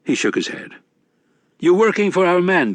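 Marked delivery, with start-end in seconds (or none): 1.6-2.7 higher, brash Irish barfly; loud, cocky drinker's voice; rowdy leprechaun. A narrator's reading. Mad Sweeney voiced in narration.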